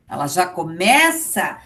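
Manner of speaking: normal talking voice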